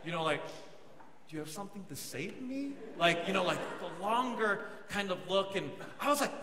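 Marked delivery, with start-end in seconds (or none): 1.3-2.7 soft, quick aside; mock intimate murmur. Preacher imagining a longer look, hushed and playful. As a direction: act it softly, as if to a crush. Acting out a lingering romantic gaze.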